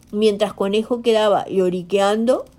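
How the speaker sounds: ordinary delivery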